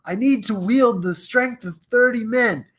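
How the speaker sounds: high, urgent melodramatic plea; hammed-up heroic desperation; straining movie hero. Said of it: "And he's like".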